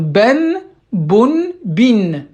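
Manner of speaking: "ben, bun, bin" one by one, each closed on a sounded n; loud, separate "ben, bun, bin"; tanwin endings. Shown as a demonstration. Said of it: "ça se prononce comment?".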